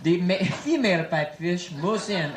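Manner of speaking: mock French-accented nature narration; low, measured documentary voice with a French accent; Jacques Cousteau. Explorer describing a sea creature to viewers, French accent. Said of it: "my name is Jacques Cousteau".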